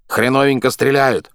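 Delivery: a wooden, grunting boar voice; grunting plywood voice. A narrator's reading. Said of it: "хрюкал он из колодца фанерным голосом".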